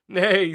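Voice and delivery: high, loud whinnying neigh; horse's neigh. Retold or imitated. Horse joining the chorus, high loud neigh. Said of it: "said the horse".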